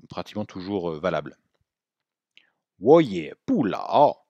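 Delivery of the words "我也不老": 我也不老 drawn out, every tone clear; careful slow Mandarin demo; slow model phrase. Shown as a demonstration. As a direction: stretch each syllable for learners. Teacher models example slowly.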